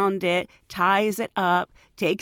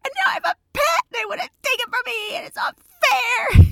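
put-on much higher, louder wailing child's shout; whining kid; 6 dB louder than usual. Boy caught with a snake wails at the flight attendant, high shouting. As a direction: bawl it out like a boy losing his pet. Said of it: "the kid immediately folds and goes".

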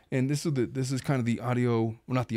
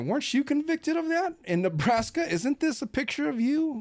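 high, mock-innocent cross-examining; mock accusing question; 12 semitones higher than usual. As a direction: act shocked while catching her out. Mock confronting her in court.

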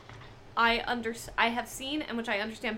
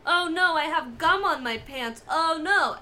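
higher, louder fake distress; mock alarmed bro; 5 semitones higher, 7 dB louder than usual. Cohost acting a bro faking panic over gum, louder. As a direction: fake a panic to bait him.

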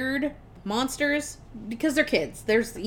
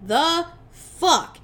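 slow, drawn-out, louder and higher what the fuck; shocked outburst; 5 semitones higher, 4 dB louder, much slower than usual. Retold or imitated. Vlad learning the family is human, slow shocked outburst. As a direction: stretch it out in disbelief, a touch theatrical.

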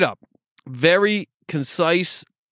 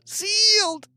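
"sealed" squeaked out in a much higher pitch; squeaky high. Pretend. Repeats word, second squeaked.

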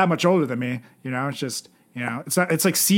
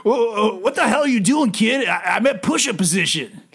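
drill sergeant; 6 semitones higher than usual. Acted. Pledge dad yells at kid.

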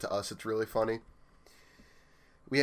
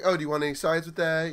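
higher, eager order-taker voice; restaurant phone worker; 6 semitones higher, 4 dB louder than usual. Retold or imitated. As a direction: play a cheerful restaurant clerk. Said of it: "like a normal person who answers the phone at a restaurant".

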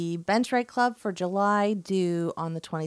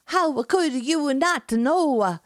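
slow, high, hammed-up Italian accent; 7 semitones higher, 4 dB louder, much slower than usual. Enzo amazed, in a slow mock Italian accent. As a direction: drag it out like a shocked Italian. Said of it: "amazing skills for accents".

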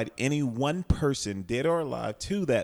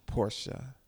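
slow dramatic whisper; 4 dB quieter, much slower than usual. Acted. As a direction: breathe the name out slowly. Whispered host name.

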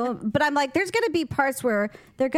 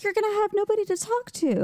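higher, fast mock-wife voice; teasing wife; 10 semitones higher, faster than usual. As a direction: tease him like his wife would. Voicing wife teasing husband.

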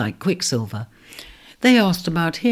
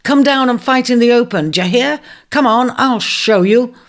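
louder, higher angry challenge; fuming; 7 semitones higher, 6 dB louder than usual. Acted. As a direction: dare them to come down and fight. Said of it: "He was fuming".

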